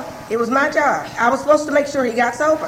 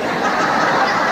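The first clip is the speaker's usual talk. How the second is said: breathy laugh. Real, not acted. Laughs at her own naivety.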